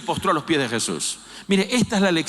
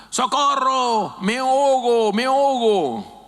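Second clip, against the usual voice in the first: loud, high, drawn-out desperate cries; drowning man yelling; 8 semitones higher, 3 dB louder, slower than usual. Acted. Drowning man shouting to his rescuers, loud desperate high cry. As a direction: yell for help like you are going under. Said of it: "estaba gritando".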